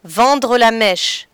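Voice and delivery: normal talking voice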